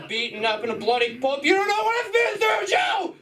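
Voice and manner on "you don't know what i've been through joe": very high shouted plea; high mock scream at Joe; desperate yell. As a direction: scream it at Joe while brawling. Brawl yell in bit.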